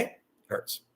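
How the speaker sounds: whispered, breathy and soft; whispered hurt. Real, not acted. Telling viewers how it feels, pained whisper. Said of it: "imagine yourself in my shoes".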